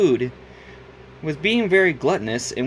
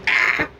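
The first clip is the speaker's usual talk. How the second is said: loud short Beavis-like yelp; scream; 4 dB louder than usual. Acted. Rat waking from a nightmare, loud scream. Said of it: "he screamed".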